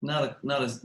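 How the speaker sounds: normal talking voice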